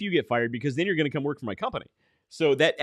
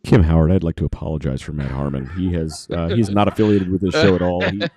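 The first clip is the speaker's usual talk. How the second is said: deep, louder disclaimer voice; put-on solemn spokesman, pitched way down; mock official apology; 11 semitones lower, 6 dB louder than usual. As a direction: apologize in a low, hammed-up official voice. Mock official apology for co-host's remark.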